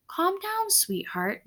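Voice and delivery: soft, gentle calming words; softly. A narrator's reading. Dad calming his upset daughter, softly. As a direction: hush her gently like a patient dad. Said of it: "Dad said softly".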